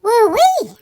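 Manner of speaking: high excited woo-wee; excited whoop. Real, not acted. Excited exclamation.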